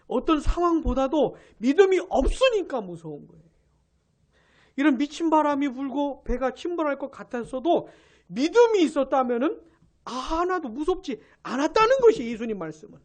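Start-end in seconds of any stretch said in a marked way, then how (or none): none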